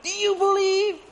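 high, loud street-preacher call; hammed-up zealous shout; excited preacher. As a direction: call it out like a train evangelist. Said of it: "super excited".

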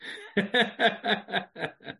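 high demonstration laugh; timed laugh. Shown as a demonstration. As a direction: laugh out freely for the count. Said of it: "Hold on".

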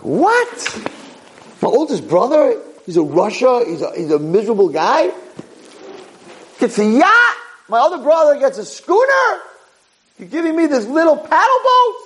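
high-pitched, incredulous protest; indignant outburst. Acted. Son protesting his brothers got better boats, indignant. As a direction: squeal it in outrage at the unfair share.

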